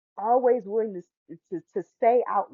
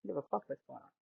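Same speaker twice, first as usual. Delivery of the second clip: much softer, half to himself; inner voice; 13 dB quieter, faster than usual. Retold or imitated. Five-year-old silently defying the gunman, much softer. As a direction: keep it under your breath, defiant. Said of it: "I'm just sitting there like".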